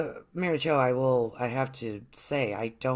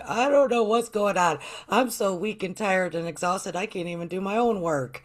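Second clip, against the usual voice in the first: higher, louder, overwhelmed plea; hammed-up helplessness, pitched up; mock helpless complaint; 5 semitones higher, 5 dB louder than usual. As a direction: act out being at wit's end. Acts out herself needing a reading.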